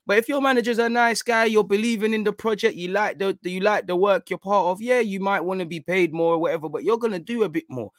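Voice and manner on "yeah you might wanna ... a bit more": ordinary talk, nothing marked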